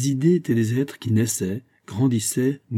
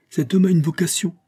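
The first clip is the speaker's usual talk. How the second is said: much higher, louder exclamation; exclaiming; 8 semitones higher, 4 dB louder than usual. A narrator's reading. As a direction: burst out in admiration. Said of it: "s'écria mon voisin". (exclamation nettement plus aiguë et plus forte)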